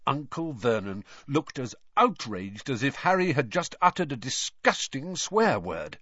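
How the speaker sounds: ordinary delivery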